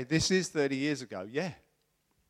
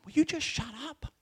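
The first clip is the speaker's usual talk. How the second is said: exasperated; 12 semitones higher than usual. Retold or imitated. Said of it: "'cause I felt like saying".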